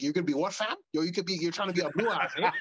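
a Toronto roadman in a red bandana confronts a guy, Toronto slang; Toronto street slang guy. Pretend. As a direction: play a tough Scarborough guy calling someone out, Toronto accent. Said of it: "a guy with the red bandana would come through like".

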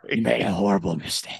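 put-on superhero voice; Batman. Redoing the line for his cohost, superhero impression. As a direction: play the caped crusader, hammed up. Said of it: "I'm doing Batman".

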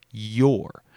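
"your" set apart and drawn out; single out the word "your" with emphasis; stress on "your". Shown as a demonstration. Quoting cousin's condolence, stressed "your". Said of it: "He emphasized the word".

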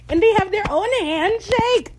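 high, loud gushing; excited squeal. Real, not acted. Fan thrilled by the friends' handshake, squealing with excitement. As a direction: squeal it with delight.

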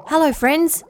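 a hint of a gurgling greeting; gurgled greeting. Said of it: "Shaggles gurgled to them".

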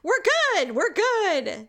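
high, called-out reassurance; all-clear call. Retold or imitated. Sister voicing the all-clear, high mock call. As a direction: announce it as if across the house.

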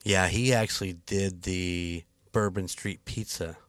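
usual speaking voice

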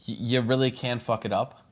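usual speaking voice

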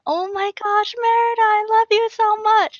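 high, loud fangirl squeal; gushing little girl. Retold or imitated. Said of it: "the little girls will come like".

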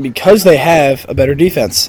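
usual speaking voice

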